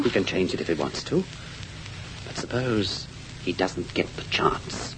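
slow, hushed scheming; sinister whisper. Acted. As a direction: drop to a conspiratorial whisper. Nephew hints at murder.

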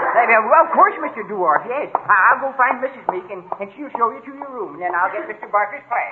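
high, loud, nervous babble; flustered, high-pitched and loud; flustered placating. Acted. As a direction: scramble loudly to please an angry guest. Flustered host scrambling to placate guest.

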